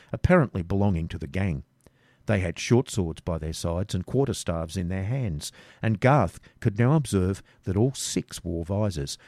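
usual speaking voice

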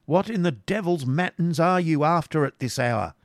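loud, high shout from outside the door; angry calling out. Acted.

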